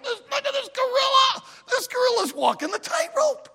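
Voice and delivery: very high, amazed shouting; yelling crowd. Acted. Crowd watching the gorilla on the tightrope, excited high yelling. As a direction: cry out like astonished spectators. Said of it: "people are yelling and screaming".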